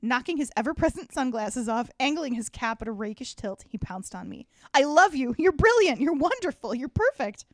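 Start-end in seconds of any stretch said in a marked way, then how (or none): 4.7-7.4 loud, high gushing praise; shower her with breathless adoration; gushing excitedly. Acted. Finney pounces on narrator gushing.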